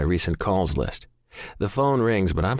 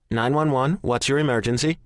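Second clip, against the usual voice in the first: operator; 6 semitones higher than usual. A narrator's reading. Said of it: "the disembodied voice answers".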